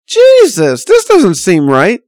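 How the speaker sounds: retold alarmed inner voice; alarmed thought. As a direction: let the worry burst out. Inner alarm at genie's device.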